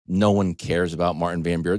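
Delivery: usual speaking voice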